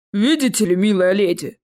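courteous troll voice; troll bass. A narrator's reading. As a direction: boom it politely as a troll. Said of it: "пробасил в ответ тролль". (вежливый бас тролля)

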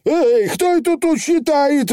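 high indignant pixie shout; indignant Feegle. Acted. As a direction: protest the cheating. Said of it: "возмутился Явор заядло".

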